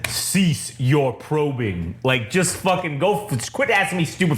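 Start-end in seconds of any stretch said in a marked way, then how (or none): 0.1-2.0 Vader voice. Retold or imitated. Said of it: "Vader just says".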